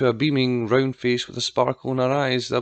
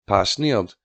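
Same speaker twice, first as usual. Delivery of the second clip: usual speaking voice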